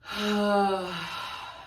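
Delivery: long, audible releasing sigh. Shown as a demonstration. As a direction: breathe out fully and audibly. Demonstrates taking a deep breath.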